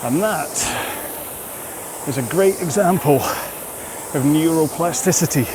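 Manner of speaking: talking while rowing. Real, not acted. Rower explains the interval mid-effort, talking through exertion. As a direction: push short phrases out between rowing strokes.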